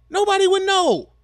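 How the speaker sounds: high, loud, disbelieving cry; exasperated shout. Real, not acted. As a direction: shout it out, fed up and amazed.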